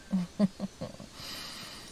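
whispered teasing giggle; soft breathy giggle. Acted. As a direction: let a sultry little laugh slip. Seductive laugh in whisper scene.

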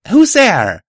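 high, loud challenge into the distance; calling out. Acted. Pony calls out to the dark wasteland, loud shout. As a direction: call out sharply to an unseen stranger. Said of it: "I called out to the dark wasteland".

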